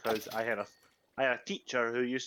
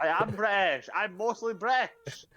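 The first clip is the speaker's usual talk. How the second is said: put-on higher, insistent British accent; trying too hard to sound British; overdone British accent; 7 semitones higher, 3 dB louder, slower than usual. Protesting to friends he is British, overdone British accent. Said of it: "trying too hard to be British".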